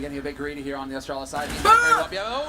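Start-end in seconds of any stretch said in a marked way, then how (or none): 1.5-2.4 excited caster shout. Real, not acted. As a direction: yell it at the clutch. Caster shouting at clutch play.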